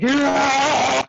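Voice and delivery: high triumphant yell; victory yell. Retold or imitated. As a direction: celebrate the knockout. Victory after knockout.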